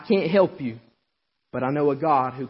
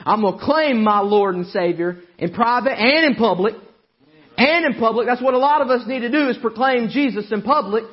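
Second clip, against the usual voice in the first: raised, driving preacher's cry; fiery preaching; 10 semitones higher, 9 dB louder than usual. Acted. Preacher shouting to the congregation about Jesus. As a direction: preach it out full force to the church.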